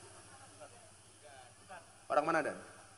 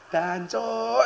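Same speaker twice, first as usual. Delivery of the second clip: self-mocking curse; 6 semitones higher than usual. Real, not acted. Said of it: "dancuk aku".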